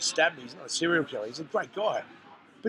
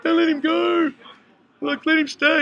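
higher, louder mock kids' plea; pleading kids; 10 semitones higher, 5 dB louder than usual. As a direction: beg like little children. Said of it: "They were like".

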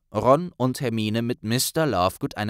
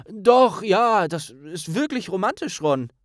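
high, louder forced agreement; dutiful agreement; 8 semitones higher, 4 dB louder than usual. Acted. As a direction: nod along to please her. Harry stimmt pflichtbewusst zu. (pflichtschuldig zustimmend, höher)

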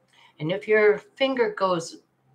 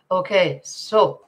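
ordinary talk

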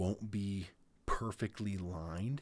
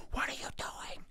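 whispered mock scream; held-back scream. Exasperated driver yelling at the car ahead, as a whispered scream. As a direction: scream it under your breath, all whisper. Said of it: "I don't wanna scream into the mic".

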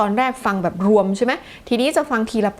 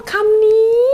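high, loud cry of recognition; sudden surprised cry; 11 semitones higher, 7 dB louder, much slower than usual. Acted. Teacher playing a student who finally recognises a word, cries out in surprise. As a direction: shriek out the discovery of the word.

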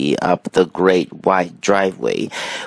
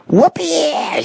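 high-pitched monkey whoop; squealed monkey cheer; excited shout. A narrator's reading. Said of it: "shouted the monkey".

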